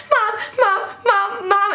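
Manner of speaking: high frightened cries of Mom; scared child calling mom. Retold or imitated. Scared sister clinging to mom.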